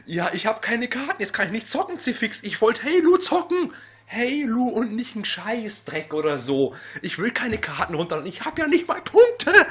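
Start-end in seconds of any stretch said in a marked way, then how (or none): 2.4-9.7 bursting out in rage; berserk rage. Real, not acted. Raging outburst. (wütender Ausbruch)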